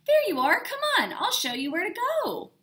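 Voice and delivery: put-on overly cheerful welcome to a new child; gushing. A narrator's reading. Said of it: "Missus Burton gushed".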